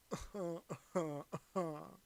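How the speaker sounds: a viewer's request, answered with a snooty English laugh; snobby rich Englishman laugh. Pretend. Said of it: "Snobby rich Englishman laughter".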